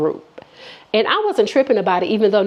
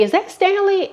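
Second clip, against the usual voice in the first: much higher disbelieving question; shocked; 10 semitones higher than usual. Real, not acted. Seeing his name in the texts, shocked high question. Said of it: "shocked recognition".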